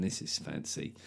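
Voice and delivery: unvoiced, hushed awe; breathy impressed murmur; whispered aside. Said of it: "you walk around going".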